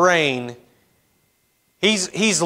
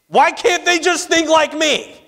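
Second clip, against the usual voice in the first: exasperated whine; 12 semitones higher than usual. Retold or imitated.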